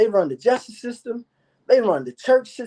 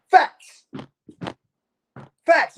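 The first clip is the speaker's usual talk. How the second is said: punched word; 7 semitones higher than usual. Real, not acted. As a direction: hammer home facts. Repeats the key word emphatically.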